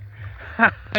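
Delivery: breathy burst of laughter; short laugh. Real, not acted. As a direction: laugh at the memory. Laughs at old memory.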